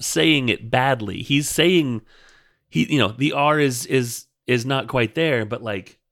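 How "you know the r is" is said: usual speaking voice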